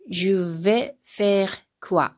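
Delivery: Je vais faire quoi said slowly with stress on vais, falling; slow French model reading. Shown as a demonstration. Teacher models the French question slowly for students. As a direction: read "Je vais faire quoi?" syllable by syllable for learners. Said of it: "読んでいきますよ".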